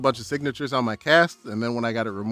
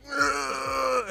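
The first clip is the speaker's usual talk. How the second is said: high pained groan. Retold or imitated. Boy describing his aching arm, pained groan.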